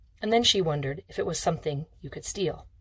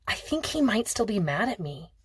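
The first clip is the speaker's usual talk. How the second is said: plain reading voice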